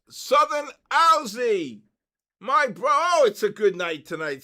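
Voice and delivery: excited. Real, not acted. Another winner drawn live.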